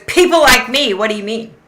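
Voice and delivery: high, loud challenge, then dropping back; acted-out offended comeback; indignant retort. Offended customer throws the words back at Victoria, indignant.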